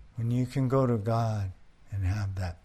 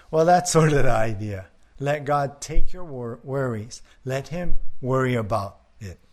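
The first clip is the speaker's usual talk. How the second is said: normal talking voice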